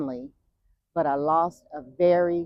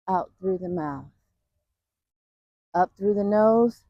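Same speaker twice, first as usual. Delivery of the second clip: guided breathing cues. Shown as a demonstration. Vlogger guides viewers through breathing on a walk, coaching voice. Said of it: "doing some, um, breathing".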